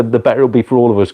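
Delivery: usual speaking voice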